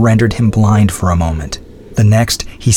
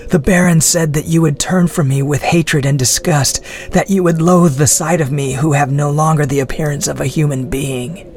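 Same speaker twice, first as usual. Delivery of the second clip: weak prisoner's voice; 6 semitones higher than usual. A narrator's reading. Andre prisoner voice.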